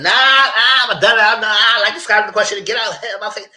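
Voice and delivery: high, loud, huffy brush-off; mock angry shout. Madison storming off from the questions, loud mock shout.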